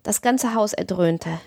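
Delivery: plain reading voice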